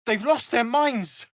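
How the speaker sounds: high, drawn-out cry of disbelief; incredulous exclamation. Acted. Exclaims in alarm during the fight.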